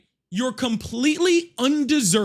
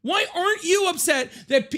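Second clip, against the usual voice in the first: ordinary talk, nothing marked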